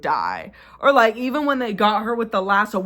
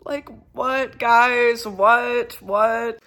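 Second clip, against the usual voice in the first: baffled what, guys; stunned exasperation at the show; incredulous; 3 semitones higher, 3 dB louder, much slower than usual. Real, not acted. Repeated disbelief at finale events.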